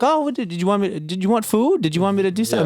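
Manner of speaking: high-pitched impression of an eager, caring mom; imitated fussing mom. Embarrassing mom checking on her child in company, high imitated fussing. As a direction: fuss over your kid in a put-on high voice. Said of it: "my mom will be funny".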